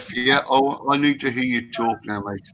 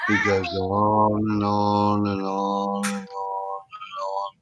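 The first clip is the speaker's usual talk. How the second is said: slow, lower, drawn-out 'on and on'; drawn out and trailing softer to mock endless ranting; dragged out; 6 semitones lower, 6 dB quieter, much slower than usual. Real, not acted. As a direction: stretch it to show it never ends. Describing endless Filipino ranting.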